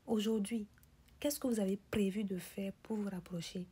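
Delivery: normal talking voice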